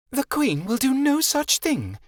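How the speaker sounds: high, slow and cutting, as a haughty fairy; sharply. A narrator's reading. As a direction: lay down the law sharply. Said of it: "Vixen said sharply".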